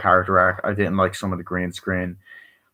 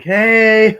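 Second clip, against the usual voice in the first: very high, loud call; hammed-up high shout; mock greeting shout; 13 semitones higher, 9 dB louder than usual. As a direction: yell to the girl. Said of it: "it's like".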